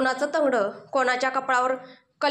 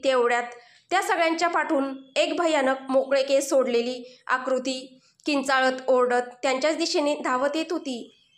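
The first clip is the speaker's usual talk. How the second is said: plain reading voice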